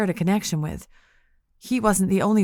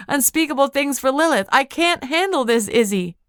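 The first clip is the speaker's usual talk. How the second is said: higher, tearful outpouring; sobbing plea; 6 semitones higher, 3 dB louder than usual. Acted. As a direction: plead through tears. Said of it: "Sobs broke through me".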